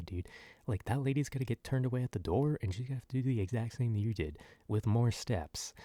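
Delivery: ordinary talk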